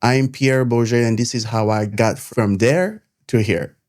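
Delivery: usual speaking voice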